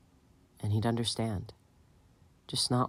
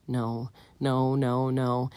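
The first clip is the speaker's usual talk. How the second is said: plain reading voice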